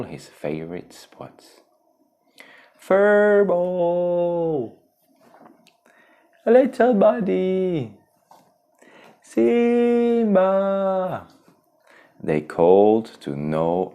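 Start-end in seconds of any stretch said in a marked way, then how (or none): 2.8-4.7 loud high call across the jungle; high anxious call. Acted. Friends calling for lost Simba. 6.5-7.9 high, slow call; drawn-out worried call. Acted. Friends calling for lost Simba. 9.2-11.3 long high shout of a name; drawn-out worried shout; calling out. Acted. Friends calling for lost Simba.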